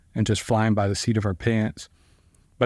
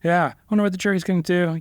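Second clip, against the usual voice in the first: mock nonchalant; 7 semitones higher, faster than usual. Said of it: "I'm all nonchalant about it".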